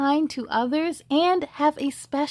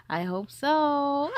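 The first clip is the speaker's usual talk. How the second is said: ordinary delivery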